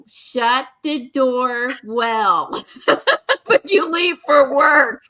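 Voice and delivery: slow, laughing bossy order; laughing note read. Retold or imitated. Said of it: "I put a note on the door".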